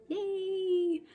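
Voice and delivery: very high, held-out yay; small cheer. Acted. Mock cheer after villain's death.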